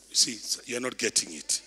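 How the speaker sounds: usual speaking voice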